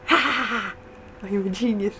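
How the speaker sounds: normal talking voice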